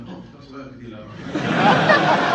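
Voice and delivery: loud, laughing mock kid; imitated celebrity's son. Kid urging parent to hire director, loud mock voice. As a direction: push it out loud, half laughing. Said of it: "Cate Blanchett's son was like".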